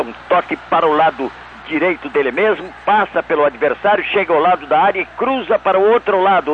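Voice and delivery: veteran radio goal narration; radio play-by-play. Shown as a demonstration. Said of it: "Faz pra nós, [name]".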